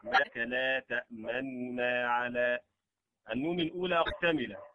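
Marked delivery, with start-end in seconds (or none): 0.0-2.6 slow, تأمننا with a partial u on the first nun; recited with ikhtilas. Shown as a demonstration. Teacher reciting ikhtilas so students learn by ear. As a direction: let them hear the snatched vowel in تأمننا. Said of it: "يضبط بالسماع".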